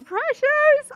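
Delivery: very high, drawn-out cooing; gushing. Real, not acted. Player cooing over a waiting Sim groom, high-pitched gush. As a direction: squeal it slowly, melting over the cuteness.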